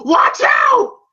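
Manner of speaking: high, loud yelled warning; Mickey's warning shout. Retold or imitated. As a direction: yell it out in mock alarm. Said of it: "you can hear Mickey go".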